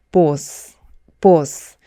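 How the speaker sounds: 보스 with a rounded Korean oh; 보스 said as BO-seu; Konglish boss. Shown as a demonstration. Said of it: "보스는 콩글리시 발음입니다". (콩글리시 발음 보스)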